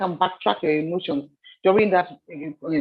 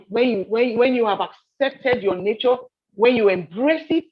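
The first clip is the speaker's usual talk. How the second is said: usual speaking voice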